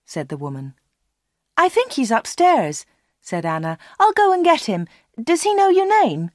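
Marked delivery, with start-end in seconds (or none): 1.6-2.9 high-pitched helpful shop assistant; helpful Anna. A narrator's reading. Anna's voice in the dialogue. 4.0-6.3 high-pitched, eager shop assistant; eager Anna. A narrator's reading. Anna offers to fetch Dave.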